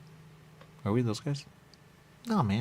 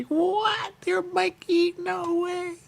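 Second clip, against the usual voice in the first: much higher and louder disbelief; amazed fan; 7 dB louder, much slower than usual. Retold or imitated. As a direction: gasp it like a stunned fan. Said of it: "No, he's the movie".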